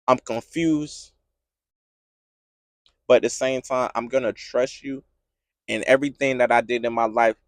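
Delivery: low, hushed prayer of pain and trust; subdued confession. Acted. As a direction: confess softly to God, voice dropped low. Voicing an honest prayer to God.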